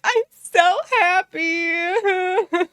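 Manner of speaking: high, very drawn-out mock sob; mock bawling. Viewer imitating the tearful happy woman, mock bawling. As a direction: wail it slowly, imitating someone crying. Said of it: "She's just bawling her eyes out like".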